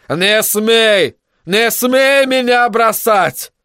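high, drawn-out desperate cry; desperate outcry. Acted. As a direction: cry it out, stretching the plea. Desperate demand not to be abandoned. (отчаянный протяжный выкрик)

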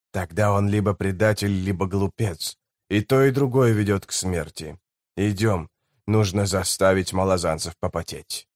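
low, soft grim voice of a hardened guide; a quiet vow of revenge, voiced low; low, soft, bitter scout; grim lowlander.

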